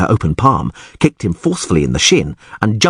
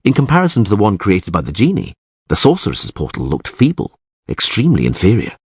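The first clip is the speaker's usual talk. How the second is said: usual speaking voice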